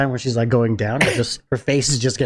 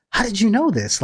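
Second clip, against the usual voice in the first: shocked; 9 semitones higher than usual. Retold or imitated. Retelling own shocked reaction.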